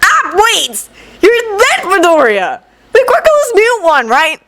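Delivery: put-on high, excited young guy; realizing with glee. Kaminari's voice in story.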